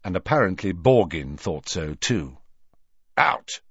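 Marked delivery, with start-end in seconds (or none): 3.2-3.6 short, high, sharp order; sharply. A narrator's reading. Borgin throwing Hermione out, sharply. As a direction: cut them off with one sharp word. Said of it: "he said sharply".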